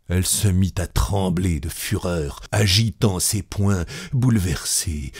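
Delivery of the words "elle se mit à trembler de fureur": ordinary delivery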